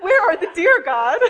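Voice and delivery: loud, high, laughing outcry; mock demand. New mother jokingly demanding her promised deer from God, loud. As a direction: call it up to heaven, half laughing.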